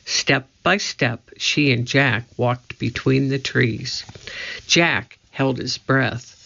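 ordinary delivery, nothing marked

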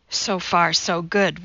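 plain reading voice

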